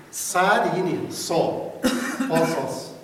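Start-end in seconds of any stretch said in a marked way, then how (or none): 2.3-2.9 قاصاص said loud with long Arabic vowels, laughing; Arabic accent demo. Shown as a demonstration. Teacher shows Arabic pronunciation of the word, laughing. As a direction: demo قاصاص with open Arabic sounds, chuckling. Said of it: "توی لهجه عربی".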